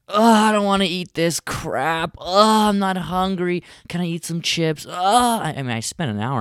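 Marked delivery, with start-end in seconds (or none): 0.1-4.9 put-on high, whiny child voice; whiny kid. Daughter refusing her dinner, high whiny child. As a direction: whine like a picky kid.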